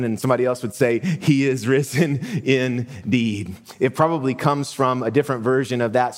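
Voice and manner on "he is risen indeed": laughing. Retold or imitated. Retold Easter greeting, laughing.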